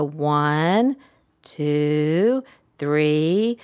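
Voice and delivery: sustained ONE, TWO, THREE, rising at the end; counting in cadence. Shown as a demonstration. Therapist counting before blowing bubbles, sing-song cadence. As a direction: draw out each number with a pause, lifting THREE. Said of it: "the same cadence".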